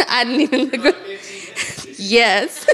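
laughing through the words, caught off guard; breaking into embarrassed laughter; real laughter. Real, not acted. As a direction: giggle at her own slip, then recover. Laughs at her own slip.

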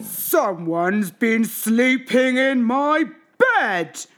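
loud, very slow bear growl; Papa Bear growling. A narrator's reading. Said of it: "growled Papa Bear".

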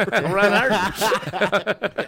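stretched out slowly through laughs; laughing. Real, not acted. Repeats name laughing.

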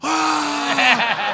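high, loud mock cry; exaggerated high "Ah!"; mock yell. Comic acting out reaction to daughter's bus joke, high mock yell.

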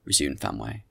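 ordinary delivery

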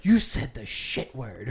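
slow, scandalized mock mom; shocked mom. [name] imitating his mom scolding a cuss word, slow and prim. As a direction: gasp then drag it out primly.

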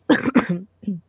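rough, chesty smoker's cough. Real, not acted. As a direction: hack out a real smoker's cough. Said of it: "Sorry, I have a smoker's cough".

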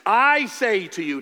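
slow and loud, I say to you; emphatic proclamation. Shown as a demonstration. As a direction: declare it with weight. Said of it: "Jesus might say".

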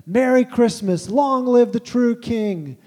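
slow, high and loud, a defiant joyful cry; booming Father Christmas, drawn out and triumphant; defiant joyful proclamation. Acted. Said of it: "defiant, joyful response".

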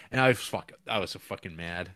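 normal talking voice

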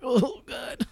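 high, breathy oh God; overwhelmed by the moment; moved exclamation. Retold or imitated. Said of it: "I was like".

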